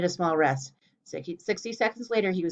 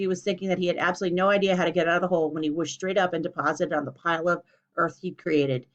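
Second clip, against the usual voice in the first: ordinary delivery, nothing marked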